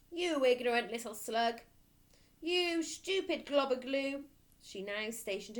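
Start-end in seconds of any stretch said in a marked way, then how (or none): 0.1-4.3 sneering headmistress. Acted. Headmistress insulting the boy, loud and contemptuous. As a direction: spit the insults out.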